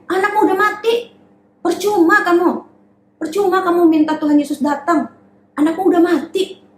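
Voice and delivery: high, sneering mock voice; nagging neighbour sneering at a grieving father; scoffing townspeople. Mocking people dismiss Jairus.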